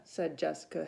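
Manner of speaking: ordinary delivery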